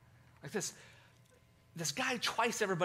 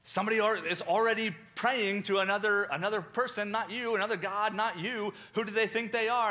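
higher, louder outraged tattling; indignant accusation; 7 semitones higher, 5 dB louder than usual. Retold or imitated. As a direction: stir up the king's anger. Conspirators accuse Daniel to king.

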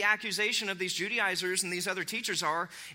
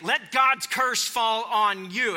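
loud, slow, higher-pitched curse; sudden escalation; 5 semitones higher, 7 dB louder, much slower than usual. Acted. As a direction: thunder it out as a fired-up apostle. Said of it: "that escalated quickly right there".

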